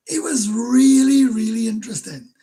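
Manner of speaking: the first really high, loud and drawn out; really stretched, high and loud; emphatic really. Real, not acted. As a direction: lean hard on the first really. Enthused about a viewer chat.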